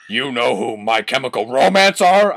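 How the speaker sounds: put-on character voice. Reading the cringe fanfic aloud, a put-on character voice. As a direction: read the goth kid's line in a different voice.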